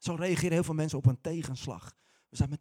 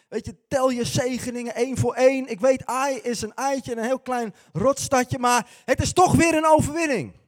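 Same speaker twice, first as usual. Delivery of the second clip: higher, louder preachy scolding; smug lecturing; 6 semitones higher, 6 dB louder than usual. Retold or imitated. Jozua lecturing Caleb about gratitude, smug and preachy. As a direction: wag a finger at the sourpuss.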